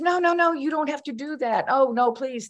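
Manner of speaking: high-pitched insistent protest; retold pleading objection; arguing. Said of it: "I started to try to argue with him".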